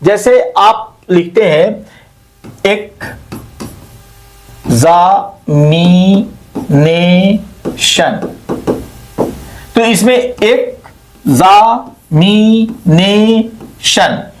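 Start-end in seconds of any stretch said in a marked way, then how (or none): 2.7-8.4 "examination" very slowly, broken into five syllables with pauses between. Shown as a demonstration. Teacher showing students what a syllable is, syllable by syllable. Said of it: "इसमें पांच syllable हैं". 10.4-14.3 "examination" slowly split into five drawn-out syllables. Shown as a demonstration. Teacher counting five syllables for students, syllable by syllable. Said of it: "इसमें पांच syllable हैं".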